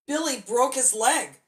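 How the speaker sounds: ordinary delivery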